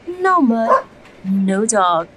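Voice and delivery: ordinary delivery, nothing marked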